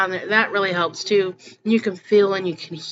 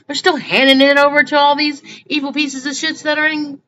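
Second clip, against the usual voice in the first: angry outburst; 6 semitones higher, 5 dB louder than usual. Real, not acted. Ranting at people who give up.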